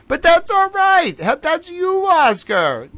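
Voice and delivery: very high squeaky pet talk. Real, not acted. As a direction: coo it up high to a puppy. Cooing at the dog.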